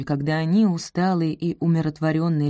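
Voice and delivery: usual speaking voice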